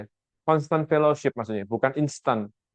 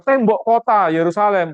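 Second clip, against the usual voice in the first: louder, slower, higher blurt; blurted wrong answer; 6 semitones higher, 5 dB louder, slower than usual. Pretend. Preacher voicing Nehemiah's imagined wrong answer, louder and slower. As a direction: spell out the risky words too plainly. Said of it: "Coba kalau dia bilang".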